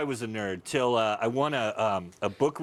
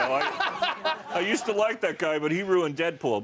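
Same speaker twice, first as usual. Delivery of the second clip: disappointed fan voice; 6 semitones higher than usual. Retold or imitated.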